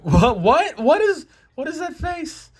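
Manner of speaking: high-pitched, stuttering disbelief; incredulous. Real, not acted. Reader sees the drawn face, incredulous. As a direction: squeal it in amused bafflement.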